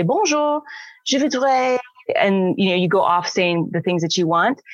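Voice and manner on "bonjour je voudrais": high, quick "Bonjour, je voudrais" skipping the pause; rushed tourist French. Retold or imitated. Tourist rushing the French shop order, high and hurried. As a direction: blurt "Bonjour, je voudrais" without waiting. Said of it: "if you say".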